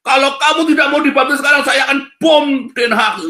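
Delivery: loud, high threatening shout; hammed-up menace, slowing at the end; mock threat. Preacher acting an evangelist threatening a bomb, loud mock shout.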